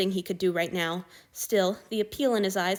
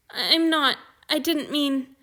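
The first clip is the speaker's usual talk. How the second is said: high, louder, halting and flustered; stammering like a child; 7 semitones higher, 5 dB louder, slower than usual. A narrator's reading. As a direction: stumble over the words, close to tears. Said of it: "he was stammering like a child".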